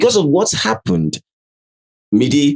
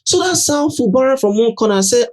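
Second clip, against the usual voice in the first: usual speaking voice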